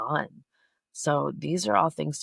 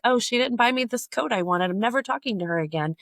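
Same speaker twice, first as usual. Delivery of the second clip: higher-pitched, quick mimicked huff; mock petty complaint; 7 semitones higher than usual. Podcaster mimicking a trivial reason to drop someone, mock petty. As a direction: imitate someone petty cutting off a friend.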